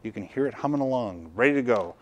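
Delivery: ordinary talk, nothing marked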